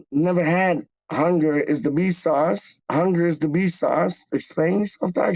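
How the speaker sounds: normal talking voice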